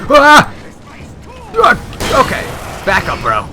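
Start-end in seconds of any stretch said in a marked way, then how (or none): 0.1-2.2 sharp startled scream; startled yell. Real, not acted. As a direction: yelp at the sudden attack. Startled by monster in game.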